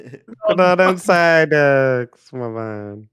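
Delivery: slow goofy drawl; goofy put-on voice. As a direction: stretch it out as a dopey dude. Imitating the goofy voice.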